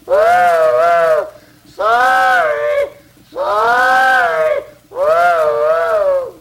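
squeaky high cartoon engine voice, loud; very high squealing sorry; flustered little engine. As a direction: sputter out the apology like a whistle. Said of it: "he looks around and he says".

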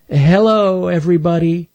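high, timid critter voice; shy greeting; Stanley's voice. Acted. Stanley greets the neighborhood animals, high and timid.

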